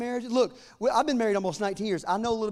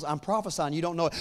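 normal talking voice

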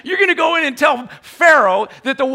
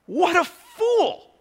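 slow, drawn-out mocking jeer; mocking onlooker; mock scorn; 5 semitones higher, much slower than usual. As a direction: sneer it like the crowd. Mocking the crowd's view.

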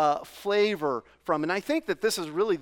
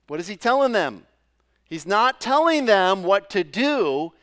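ordinary talk

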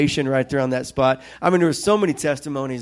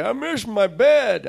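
high, drawn-out parental whine; mock complaining; 7 semitones higher, slower than usual. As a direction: complain in a higher voice, stretching it out. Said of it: "would complain and say".

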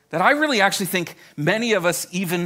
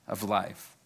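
usual speaking voice

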